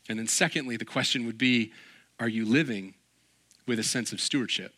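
ordinary talk

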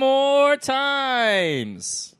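drawn-out booming announcer call, very slow; fighting game announcer. Acted. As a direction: stretch every word like a ring announcer. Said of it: "I like your announcer voice".

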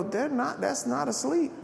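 high, hushed disbelief; a mourner scoffing at Jesus, high and hushed; incredulous retort. Retold or imitated. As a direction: protest in a high voice that she's clearly dead.

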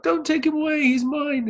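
put-on high pleading child's cry; crying kid. Podcaster imitating a kid begging for E.T. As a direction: beg like a crying kid losing his friend.

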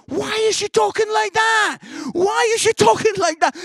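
very loud, high-pitched complaint; exasperated mock shout. God in heaven complaining about whispering Wendy, exasperated shout. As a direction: bellow it in comic disbelief.

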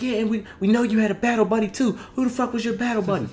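fast, high, barked questioning; drill sergeant voice. Retold or imitated. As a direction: grill him like a drill sergeant. Retold drill sergeant interrogation.

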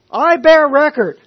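loud, high, BEAR stressed; confident declaration. Retold or imitated. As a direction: proclaim it with full conviction. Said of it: "with full confidence".

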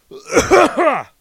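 a big, loud, high cough to get attention; loud fake cough. Real, not acted. Customer coughing at the clerk to be noticed, loud. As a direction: cough hard and loud into the mic. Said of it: "that was really loud in the mic".

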